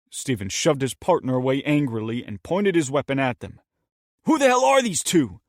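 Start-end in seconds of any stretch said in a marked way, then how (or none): 4.2-5.4 loud, high, hostile challenge; a furious shout of a question; angry demand. Acted. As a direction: bark it at the intruders. Steven confronts the strangers.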